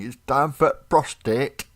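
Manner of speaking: mock-ominous doctor announcing the exam. Mocking a doctor snapping on gloves, hammed-up and slow.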